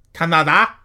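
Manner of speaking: high, loud, mock French accent with broad A's; terrible French accent. Podcaster names listeners' country, hammed-up terrible French accent. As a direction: badly fake a French accent, rising at the end. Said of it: "That's a terrible accent".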